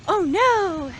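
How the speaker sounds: sharp gasp, then a high, loud oh no; startled gasp. Real, not acted. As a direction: gasp in mock alarm. Crossing road without crosswalk.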